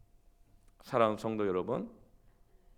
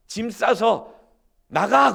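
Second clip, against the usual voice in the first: much higher, loud angry yelling; husband yelling; 10 semitones higher, 10 dB louder, much slower than usual. Retold or imitated. Gyeongsang husband yelling at his wife in a fight. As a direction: shout your frustration at your wife. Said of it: "소리를 지르면서 싸웠어요".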